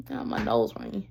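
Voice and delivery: unvoiced whispered aside. Real, not acted. Vlogger murmuring about her nose, whispered. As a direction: drop to a whisper to yourself. Aside while telling hard story.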